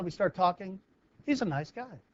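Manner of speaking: usual speaking voice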